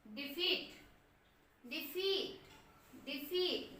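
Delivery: डिफीट with the ई on फी, short डि; डिफीट, short di then a long feet; stress on feet. Shown as a demonstration. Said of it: "डी में नहीं आ रहा".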